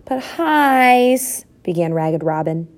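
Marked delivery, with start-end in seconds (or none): 0.0-1.4 high, drawn-out whine of objection; protesting husband. A narrator's reading. Husband starts to object to his wife, protesting. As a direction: draw out the name in a high plea.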